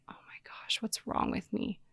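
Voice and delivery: whispered, unvoiced inner alarm; panicked whisper. Panicking to herself after husband's remark, in a frightened whisper.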